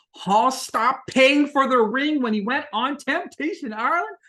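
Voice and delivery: high, slow, drawn out in disbelief; shocked disbelief. Real, not acted. Vlogger relaying scandalous news to viewers, high and stunned. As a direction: let the shock lift the whole line.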